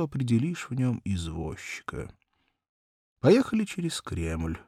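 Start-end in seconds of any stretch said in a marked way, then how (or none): none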